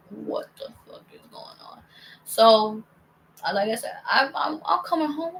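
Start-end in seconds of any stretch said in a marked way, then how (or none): 0.1-1.8 hushed, voiceless disbelief; stunned whisper. Retold or imitated. Storyteller recalling her shock at the news, stunned whisper.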